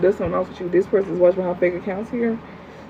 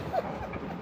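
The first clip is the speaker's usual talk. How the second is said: high breathy laugh; 15 dB quieter than usual. Real, not acted. Laughing at the commenter.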